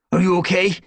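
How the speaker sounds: loud, high urgent question; demanding. A narrator's reading. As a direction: demand an answer. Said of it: "Brambleclaw demanded".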